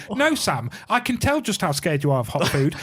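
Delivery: high, hammed-up impression of a smug fan; mock listener voice. Mocking the podcast listeners who made them eat it, in a high put-on voice. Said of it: "and going".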